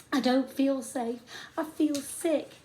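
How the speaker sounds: frightened pleading. A narrator's reading. Frightened boy on rock.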